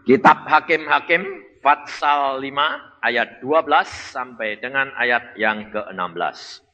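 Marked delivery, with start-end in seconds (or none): none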